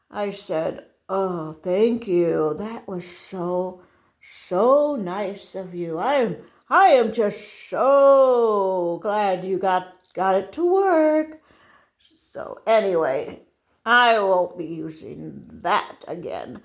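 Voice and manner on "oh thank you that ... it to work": slow, drawn-out gushing gratitude; syrupy, hammed-up appreciation; effusive thanks. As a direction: lay the thanks on thick, slowly.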